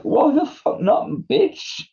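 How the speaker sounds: high squealing mock outrage, gone breathy on the last word; mock outraged squeal. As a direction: squeal it like a mock indignant comedian. Tom's outraged reply.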